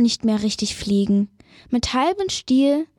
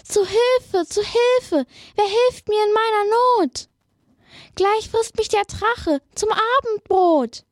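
cry for help; 10 semitones higher than usual. Acted. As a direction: call desperately for help. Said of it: "heraus kommt ein Schrei".

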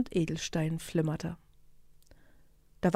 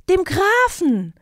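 much higher, louder, slow crowd shout; shouted in unison; 11 semitones higher, 8 dB louder, slower than usual. Acted. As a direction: call it out together across the field. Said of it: "riefen alle".

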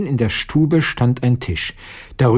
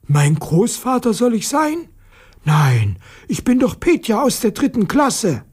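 high-pitched protesting schoolboy; indignant disbelief; 10 semitones higher than usual. A narrator's reading. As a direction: protest the mistake in a strained character voice. Boy protests being taken for grandfather. (ungläubig protestierend, mit hoher Stimme)

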